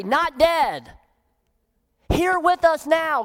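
ordinary delivery

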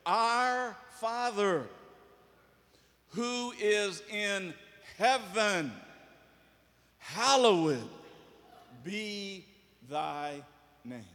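slow, drawn-out prayer, each word held; slow prayer recitation. Shown as a demonstration. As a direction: pray it out word by word, slowly. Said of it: "Pray this way".

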